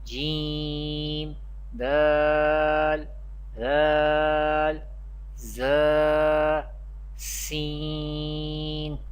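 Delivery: Arabic-accented letter names, long sustained vowels on "Jeem", "Seen", "Qaf". Shown as a demonstration. Teacher listing the fifteen Ikhfa letters, Arabic drawn-out recitation. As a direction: recite each Arabic letter like "Sheen" on one level, drawn-out note. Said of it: "Ikhfa letters in an Arabic accent".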